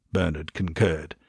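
usual speaking voice

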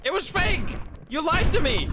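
high-pitched betrayed cry; indignant accusation. Real, not acted. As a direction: wail at whoever fooled you. Accuses game of lying.

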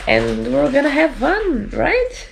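high sing-song voice to the dog; cooing at the puppy in a high voice; dog-talk high voice. Acted. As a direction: pitch way up, playful. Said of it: "I can't have my high voice".

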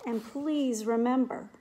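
gentle, measured storybook reading; reading a picture book aloud. Acted. Teacher reading a picture book to her class, gentle storybook voice. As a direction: read each line to the children like a bedtime book.

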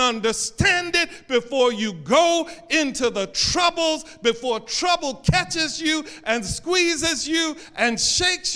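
loud, high preaching, slowing right down on the last line; preaching Jesus' words. Acted. Preacher voicing Jesus to the church, proclaiming loudly. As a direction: lift it up and proclaim it to the congregation.

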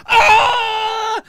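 high, loud yell like the man on the train; a short shrieking "Ah!"; imitated crazy yell. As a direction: let out a sudden mad high shriek. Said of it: "And then he goes".

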